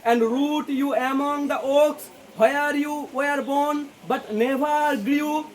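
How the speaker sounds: slow, drawn-out, weighty poetic reading; slow poem recitation. Shown as a demonstration. Teacher reading the poet's lines to students, slow recitation. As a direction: stretch each word like reciting verse. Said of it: "কবি বলছে".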